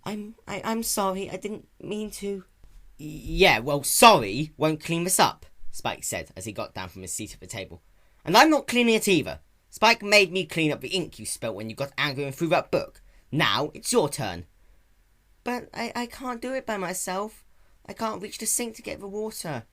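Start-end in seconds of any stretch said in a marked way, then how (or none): 0.0-2.5 frightened apology. A narrator's reading. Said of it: "frightened by her own outburst". 3.0-5.5 louder, slow and sharp; curt scolding. A narrator's reading. Spike scolding Nyx. 8.2-14.5 much higher, louder, stern; resentful refusal. A narrator's reading. Spike refusing to clean. 15.4-19.6 high small voice; helpless pleading. A narrator's reading.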